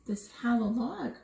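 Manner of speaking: ordinary delivery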